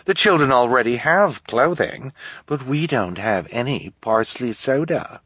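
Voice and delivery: high, dismissive socialite; politely impatient. Acted. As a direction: wave the problem away. Esme dismissing children.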